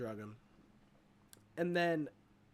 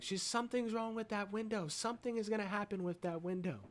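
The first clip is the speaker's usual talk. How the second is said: raised-pitch imitation of someone warning; mock ominous warning, pitched up; retold urgent warning; 7 semitones higher than usual. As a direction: warn insistently in a higher voice. Acting paranormal lady's warning.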